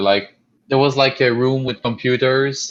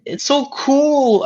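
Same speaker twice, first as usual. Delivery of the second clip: very high, drawn-out mock gushing; high-pitched impression of an impressed brother; imitating excited brother; slower than usual. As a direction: gush slowly in a squeaky high voice. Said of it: "my brother was like".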